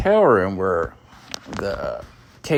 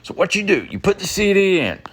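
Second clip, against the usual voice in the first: jacked up; 7 semitones higher than usual. Retold or imitated. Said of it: "he got all jacked up".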